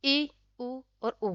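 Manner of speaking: ई, उ और उ said slowly with the mouth barely open; teacher modelling close vowels ई, उ, उ; slow close vowel demo. Shown as a demonstration. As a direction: softly stretch ई, उ और उ, mouth almost closed. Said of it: "संवृत स्वर आपके ई".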